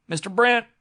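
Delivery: loud, high calling shout. A narrator's reading. Frightened servant calls his employer to open up, shouting. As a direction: call out to the cabin door. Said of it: "shouted Ashley".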